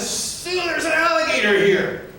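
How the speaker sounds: very high, squealed alarm without volume; mock girlish panic. Man warns his fishing partner of an alligator, mock panic. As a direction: imitate yourself squealing like a little girl. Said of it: "I screamed like a little girl".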